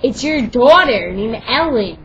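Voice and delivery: loud, slow, high scolding shout; loud yelling. Real, not acted. Girl mid-story yelling at someone nearby, loud and scolding. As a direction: yell it across the room.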